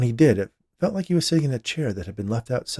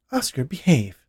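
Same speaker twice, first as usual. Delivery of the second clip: mildly embarrassed reproach, higher pitched; 6 semitones higher, 5 dB louder than usual. A narrator's reading. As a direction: scold your husband, a touch embarrassed.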